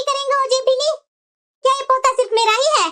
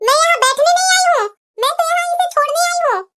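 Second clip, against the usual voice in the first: normal talking voice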